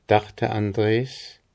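usual speaking voice

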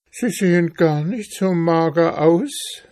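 high-pitched, pleading little mouse; poor hungry mouse, pitched high; plaintive mouse. Acted. Mouse answers in the story.

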